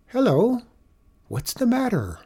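put-on high little-boy voice. A narrator's reading. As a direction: pipe up like a small child. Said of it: "The little boy stopped".

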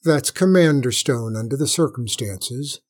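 plain reading voice

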